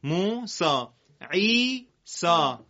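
موسا and عیسا read slowly, ending in a long a sound; stretched final vowel; slow model reading. Shown as a demonstration. Teacher models the silent ya for the class. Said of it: "موسا خونده نمیشه".

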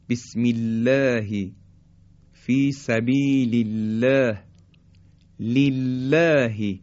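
بسم الله with a light, thin lam, slow. Shown as a demonstration. Teacher demonstrating thin lam in recitation. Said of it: "مثل".

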